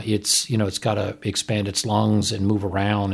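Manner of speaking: ordinary delivery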